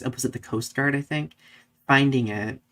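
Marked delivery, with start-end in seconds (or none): none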